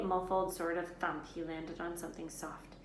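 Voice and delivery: ordinary delivery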